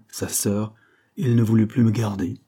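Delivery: ordinary delivery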